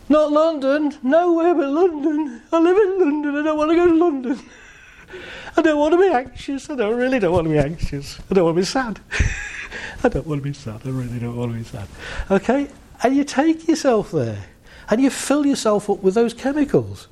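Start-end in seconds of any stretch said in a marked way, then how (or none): 0.1-11.9 high mocking whine of an anxious mind; whiny anxious voice. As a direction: play the fretting mind, pitched up.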